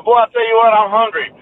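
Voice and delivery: emphatic Southern exclamation; Southern saying demo. Shown as a demonstration. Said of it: "you say that before you quote".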